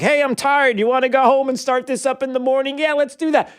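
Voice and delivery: high sarcastic mock voice; lightly hammed-up yawny election workers; mocking officials calling it a night; mock sleepy officials. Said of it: "everybody's like".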